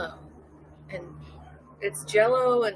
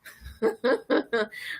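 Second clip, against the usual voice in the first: loud nervous laughing fit; pained laugh; 4 semitones higher, 4 dB louder than usual. Real, not acted. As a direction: laugh hard to cover the hurt. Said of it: "I'm laughing because it's a lot of pain".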